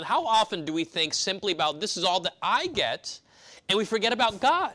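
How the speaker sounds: preacher climbing to a fervent high point; building preaching. Acted. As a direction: build the sermon toward its peak. Preaching climax.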